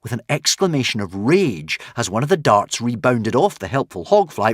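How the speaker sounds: plain reading voice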